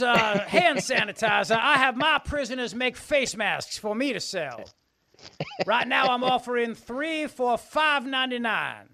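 loud Southern huckster pitch, slow; booming Southern governor sales pitch; Southern accent. Pretend. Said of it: "It's my Southern accent".

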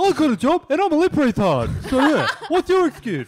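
mock caseworker voice. Mocking the lisping job agent.

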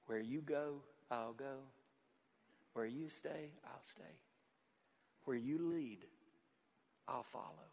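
very soft, spoken song lyrics with long pauses; hushed song quote. Retold or imitated. Pastor reciting the song from the car radio, hushed. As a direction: let each line sink in, almost under the breath.

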